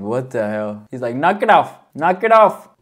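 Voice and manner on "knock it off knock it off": a light imitation of an Indian-Mexican accent on the line; playful Indian-Mexican accent impression; mock Indian-Mexican accent. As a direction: copy the actor's Indian-Mexican-sounding accent, a bit louder. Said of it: "he sounds like Indian slash Mexican".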